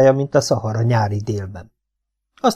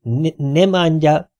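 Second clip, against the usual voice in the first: slow, high-pitched foreigner talk; halting, drawn-out words for someone who doesn't understand; broken speech; 6 semitones higher, slower than usual. A narrator's reading. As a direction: spell it out slowly, stumbling at the start. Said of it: "törte a szót Domi".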